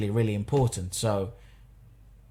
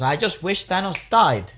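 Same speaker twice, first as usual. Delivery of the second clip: mock wish; 6 semitones higher, 5 dB louder, slower than usual. Voicing a wish to the stone.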